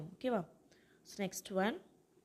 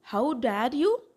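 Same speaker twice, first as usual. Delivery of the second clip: loud indignant demo, rising at the end; punchy mock outrage, each word hit hard; scolding; 3 semitones higher, 9 dB louder, much slower than usual. Shown as a demonstration. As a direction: demonstrate a mother's telling-off. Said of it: "அம்மாலாம் திட்டுவாங்க இல்லையா".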